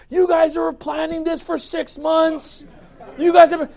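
mock shout of an angry ex-member; accusing shout. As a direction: hurl the accusation at him. Angry church members texting accusations.

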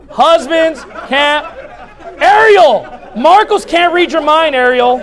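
loud, high, playful call-out. Real, not acted. Pastor calling out a wife in the congregation, loud and teasing. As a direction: raise the voice to single out one listener.

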